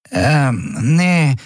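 high, hesitant little voice; hesitate, then deny it in a high voice; evasive Nelen. A narrator's reading. Small crewmate dodging Xea's question, hesitant.

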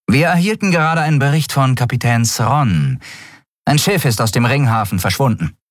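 high-voiced officer briskly reporting to the admiral; urgent, high report to the commanding officer; first officer reporting. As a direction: pitch her voice up as a crisp officer giving a report. Shia's voice in the audiobook.

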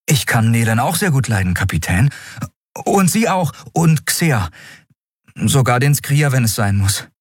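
put-on boy lists everyone he likes, teasing; cheeky young Miko. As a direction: count them off with a grin. Miko replies playfully.

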